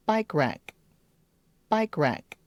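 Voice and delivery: rack with lips rounded for the R. Shown as a demonstration. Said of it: "round your lips for the R".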